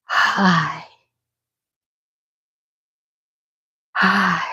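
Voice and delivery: mock-sexy breathy hello, low and soft; sultry accented friend. Host teasingly imitates her friend greeting, soft and sultry. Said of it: "And she's like".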